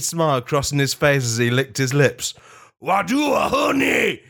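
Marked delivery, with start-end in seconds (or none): 2.8-4.2 high, loud bellow for honey; bellowing Pooh. Acted. Winnie the Pooh bellowing at a bee for honey, loud and high. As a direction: roar it at the bee like a greedy bear. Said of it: "he bellowed at the bee".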